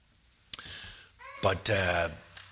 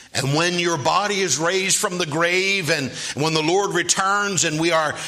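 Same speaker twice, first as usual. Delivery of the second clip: loud, high revival preacher; full-force preaching; 10 semitones higher, 6 dB louder than usual. Real, not acted. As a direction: drive it out to the whole church, high and loud.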